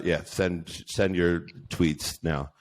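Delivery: usual speaking voice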